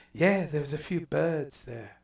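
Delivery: slow, hammed-up British accent, mock Brit at the pub; bad English accent. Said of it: "enough bad English accents".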